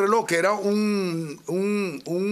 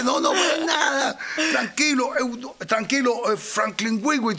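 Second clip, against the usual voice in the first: mugger's voice; 7 semitones higher than usual. Retold or imitated. Robber reassures, sings his name.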